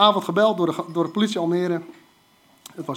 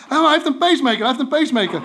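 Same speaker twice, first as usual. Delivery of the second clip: screaming wife; 6 semitones higher, 4 dB louder, faster than usual. Retold or imitated. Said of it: "zijn vrouw begint te schreeuwen".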